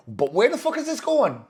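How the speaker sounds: high-pitched incredulous blurt, retold; baffled inner voice. Man recalling his own thought mid-exercise, baffled. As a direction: act out a bewildered thought.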